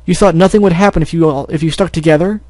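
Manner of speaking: angry scolding. Acted. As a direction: scold her hard, rising in anger. Angry brother scolds sister.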